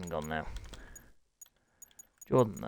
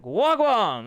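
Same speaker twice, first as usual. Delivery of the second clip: loud greeting; 10 semitones higher, 8 dB louder than usual. Real, not acted. Greeting a joining rider.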